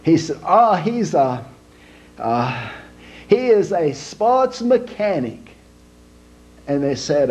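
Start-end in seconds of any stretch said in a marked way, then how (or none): 0.5-5.4 put-on slow, proud Italian accent; Italian papa boasting slowly in an Italian accent. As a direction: brag about your son in a broad Italian accent. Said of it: "an Italian man named Big Lorenzo".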